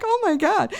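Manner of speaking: a giddy disbelieving cry; overwhelmed oh my God. Retold or imitated. Hearing the dog can come back, squealing in disbelief. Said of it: "And I'm like".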